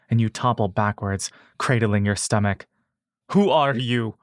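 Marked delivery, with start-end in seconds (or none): 3.3-4.2 shout. A narrator's reading. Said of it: "you shout".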